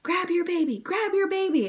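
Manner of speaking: high, loud urgent call; retold shout. Staff to the mother pushing, high urgent shout. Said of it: "them screaming to me".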